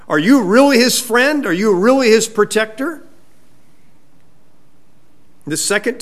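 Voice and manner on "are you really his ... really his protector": higher, louder doubting questions; challenging questions. Retold or imitated. David doubts Abner protects Saul, challenging him. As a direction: press him on "really", pitched up.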